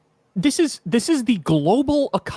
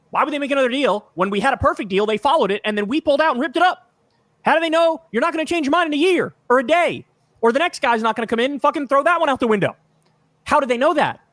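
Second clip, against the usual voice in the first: exasperated outrage; 8 semitones higher than usual. Real, not acted. Exasperated rant about broken agreements.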